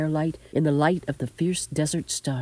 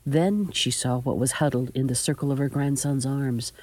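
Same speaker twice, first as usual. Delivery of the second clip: ordinary delivery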